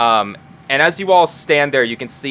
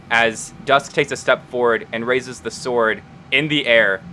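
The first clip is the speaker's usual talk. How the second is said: ordinary delivery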